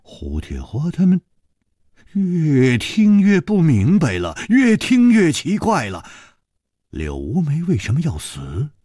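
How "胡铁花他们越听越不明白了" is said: ordinary delivery, nothing marked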